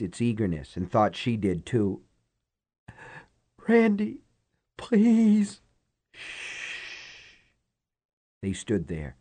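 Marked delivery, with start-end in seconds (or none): 3.7-5.6 frightened pleading. Acted. Frightened woman begging. 6.1-7.4 hushing. Acted. Hushing her.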